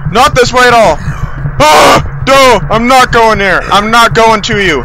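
high, loud cries of refusal; loud panicked yelling. Real, not acted. Player running from the creature, loud panicked yelling. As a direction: yell in alarm, pitch way up. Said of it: "That's so loud".

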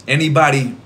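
normal talking voice

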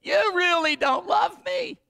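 high, loud wailing complaint, half-hammed; a hammed-up tearful wife begging her husband; mock sobbing plea. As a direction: play the weeping bride, pleading and whining. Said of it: "she starts sobbing".

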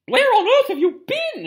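high, loud, shocked demand; scolding portrait lady. Acted. Fat Lady to children back late, high scolding. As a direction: cry it out indignantly.

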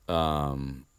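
normal talking voice

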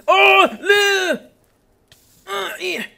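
long, high drawn-out groan of dismay. Real, not acted. Gamer spotting lava, wailing oh no. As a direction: stretch out the oh no in alarm. Lava danger in Minecraft cave.

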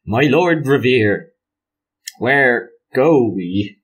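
slow, laboured West Country character; halting Somerset attempt, very drawn out; awkward Somerset accent. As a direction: stretch each vowel in awkward Somerset. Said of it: "somewhat awkwardly".

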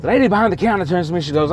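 ordinary talk, nothing marked

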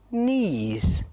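plain reading voice

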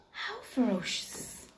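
timid lion. Acted. Lion worried.

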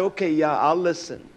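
meek quiet agreement to a critic; mild soft reply. Retold or imitated. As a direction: say it softly and give in. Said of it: "I say".

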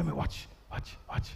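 hushed whisper, almost breathless. Real, not acted. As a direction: drop to a whisper. Preacher hushes crowd.

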